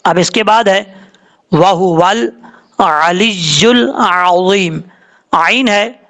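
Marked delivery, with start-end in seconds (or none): 1.5-4.8 slow chanted अलियुल Azeem; tajweed chanting; Quranic recitation. Acted. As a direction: chant it out slowly. Reciting the verse.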